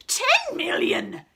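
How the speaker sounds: high, loud, slow archduke shout; furious archduke shout. Acted. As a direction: bellow the offer. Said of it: "shouted the Archduke".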